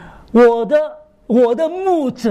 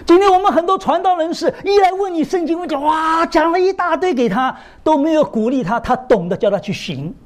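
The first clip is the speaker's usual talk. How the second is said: high, raised preacher's voice, urging the flock; preaching at full force; 8 semitones higher than usual. Acted. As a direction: pitch it up and drive the point home like a pulpit sermon.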